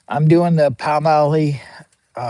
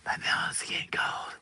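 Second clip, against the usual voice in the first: breathy whispered repeat; secretive hushed whisper; 14 dB quieter than usual. Shown as a demonstration. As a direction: drop to a whisper and say it again.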